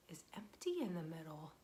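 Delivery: ordinary delivery, nothing marked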